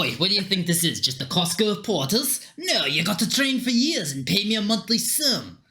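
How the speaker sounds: indignant Scottish businessman's voice; Scottish accent. Acted. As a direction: scold them in a Scottish brogue. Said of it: "Don't know why he's Scottish".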